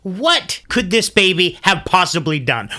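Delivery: plain reading voice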